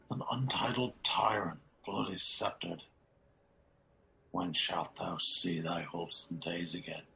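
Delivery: usual speaking voice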